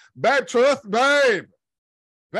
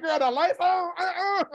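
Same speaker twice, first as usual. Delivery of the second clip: put-on sassy girl reaction; 10 semitones higher than usual. Mock reaction at party lights.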